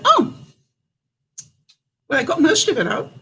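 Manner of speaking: high squeak then sheepish admission; sheepish surprise. Retold or imitated. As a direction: play caught-out innocence. Seeing flames through roof.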